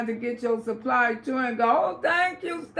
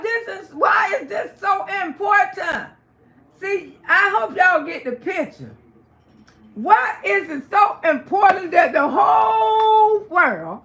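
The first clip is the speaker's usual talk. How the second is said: slow, high, loud outraged question; indignant shout; 6 semitones higher, 5 dB louder than usual. Real, not acted. Auntie ranting to viewers about the rumors, slow outraged shout. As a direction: demand it, drawing every word out.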